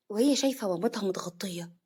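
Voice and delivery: plain reading voice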